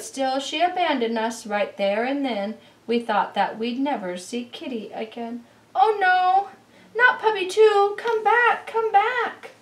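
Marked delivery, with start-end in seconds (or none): 5.7-9.5 high, loud cry to come back; high loud desperate call. Acted. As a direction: shout after the runaway puppy. Calling after runaway Puppy.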